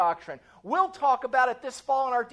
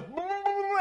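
high, drawn-out frightened moan; comic whimper held on one high note; cowardly lion wail; 7 semitones higher than usual. Acted. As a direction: imitate a terrified lion's quavering hum. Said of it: "the cowardly lion goes".

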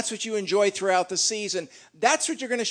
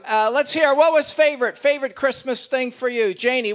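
higher and louder, pulling the room's attention; calling out; 5 semitones higher, 5 dB louder than usual. Real, not acted. Pastor asking the congregation for favorites, calling out loudly. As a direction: project it across the hall to get answers.

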